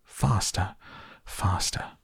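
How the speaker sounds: ordinary delivery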